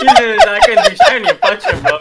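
laughing. Real, not acted. Laughing at his own fight story.

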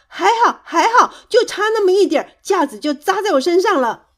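high, loud angry outburst; loud, indignant scolding. A narrator's reading. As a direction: snap back hotly, racing through the complaint. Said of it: "蹦蹦生气地说".

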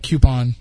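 "coupon" with a y-glide, KYOO-pon; disputed kew-pon. Retold or imitated. Host citing a similar mispronunciation, "coupon" as KYOO-pon. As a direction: begin "coupon" like cue.

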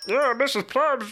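high, slow, silly disguise voice; another superhero voice. Pretend. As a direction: drag it out in a goofy high put-on voice. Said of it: "I'll do another voice".

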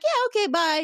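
high, quick chirpy bye; abrupt goodbye. Retold or imitated. Picard cutting off the chat with Troi, abrupt high bye. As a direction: toss off a goodbye and leave.

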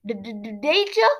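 stammer, then blurt it in alarm; acted scared question, pitch shooting up; frightened stammer.

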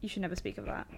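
hushed, voiceless warning; whisper. Real, not acted. Friend hushing the unknown man's secret, whispered. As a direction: whisper it like a secret never to tell.

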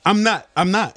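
ordinary talk

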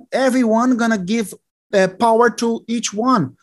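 ordinary talk

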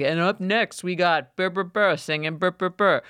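a mock MC calling the next singer; karaoke MC. Imitating the bar karaoke MC announcing the next singer. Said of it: "doing the M C night".

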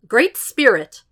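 addressing a god reluctantly; formal address, swallowing pride. A narrator's reading. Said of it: "swallowing his pride".

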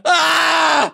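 sudden high shout of alarm; cartoon scream of surprise; mock scream. Screaming while lifted by fizzy drink.

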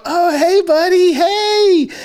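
high, loud, drawn-out friendly hey; gushing high-pitched greeting; overfriendly greeting. Retold or imitated. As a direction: imitate someone being extra nice to a kid. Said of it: "Joel's being good, so cool".

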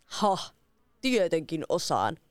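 slow, smug exclamation of the wicked wizard; boastful wizard. A narrator's reading. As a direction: wizard scoffing at the challenge. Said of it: "huudahti velho".

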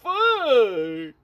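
slow, high stunned disbelief; drawn-out high what the fuck; shocked. Real, not acted. Shocked at tall mountains.

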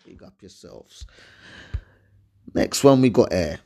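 normal talking voice

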